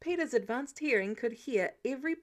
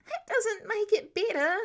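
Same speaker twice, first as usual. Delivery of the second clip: high, loud whine; whiny complaint; 9 semitones higher, 5 dB louder than usual. A narrator's reading. Peter whining to Tony after the visit, high and loud. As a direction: whine it like a miserable teen.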